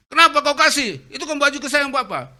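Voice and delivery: loud, high, fast angry scolding; furious father. Retold or imitated. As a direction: snap at the son in outrage.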